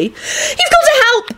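high, drawn-out frantic plea; frantic high-pitched girl; frantic yell. A narrator's reading. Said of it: "Esther yelled frantically".

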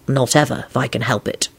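low, resolute refusal. A narrator's reading. Lupin refusing to see her again, resolute. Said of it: "he replied resolutely".

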